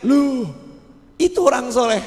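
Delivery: the sinner surprised to see the pious man; surprised recognition. Acted. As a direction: spot him and exclaim. Criminal spots the righteous man.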